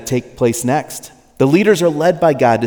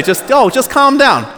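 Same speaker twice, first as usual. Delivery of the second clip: mock dismissive; 10 semitones higher, 4 dB louder than usual. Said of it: "When you say".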